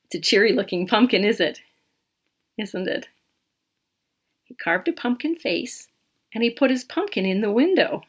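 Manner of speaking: usual speaking voice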